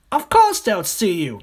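high, loud little-croc retort; scoffing smaller crocodile. Acted. Smaller crocodile doubting the big one's plan, high and scornful. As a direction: shoot back in high-pitched scorn.

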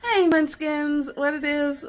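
high, loud vlog hello with punch on hey; big, slow showy channel greeting; hyped intro greeting. Acted. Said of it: "my intro was mad funny".

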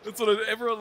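normal talking voice

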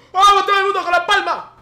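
sudden high shout to the whole carriage; shouted call. Retold or imitated. Busker yelling at the subway crowd, loud shout. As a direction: bark it out like a street busker. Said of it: "escuchamos un grito".

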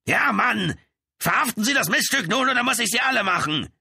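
high, loud, aggressive yelling; threatening fury. A narrator's reading. Brother demands arrest and threatens the police, yelling. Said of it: "sein Ton wurde immer bedrohlicher".

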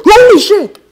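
very high, loud yell; shocked shriek. Real, not acted. Player startled by a ninja attack, shocked shriek.

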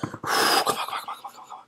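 rapid breathy whisper; hushed, repeated pleading; whispered urging. Real, not acted. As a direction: urge it on under your breath. Urging the game under breath.

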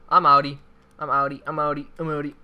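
loud, hurried bailing out; panicked escape. Real, not acted. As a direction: shout it while running away. Fleeing a team of two.

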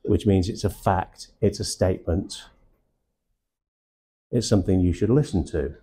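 measured statements, each closing on a fall like a settled fact; downward terminal. Shown as a demonstration. Trainer showing the room a statement, downward terminal on each phrase. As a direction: drop the pitch at the end of "fact", "statement" and "listen to". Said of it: "I go down at the end of a sentence".

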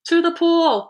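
high, loud rallying cry; urgent storybook shout; yelled echo. A narrator's reading. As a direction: yell it out, pitch right up. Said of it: "I yelled".

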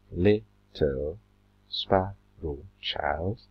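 drawn out very slowly, syllable by syllable; counting syllables. Shown as a demonstration. Teacher counting syllables aloud for the class, very slow. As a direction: stretch each syllable to count it. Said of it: "Second line".

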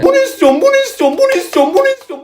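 high repeated whiny demand; whiny child tantrum. Acted. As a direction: whine like a spoiled kid.

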